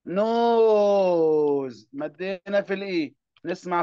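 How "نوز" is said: نوز with the long o held very long; slow teacher modelling of a long vowel; stretched long o. Shown as a demonstration. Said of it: "زي كلمة".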